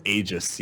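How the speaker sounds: "Aegis" said AY-jis; AY-jis pronunciation. Shown as a demonstration. Host gives other side of the schism, AY-jis. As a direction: open "Aegis" on a long ay. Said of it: "schism of who pronounces".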